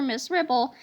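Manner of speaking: ordinary delivery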